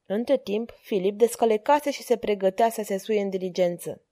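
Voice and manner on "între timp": plain reading voice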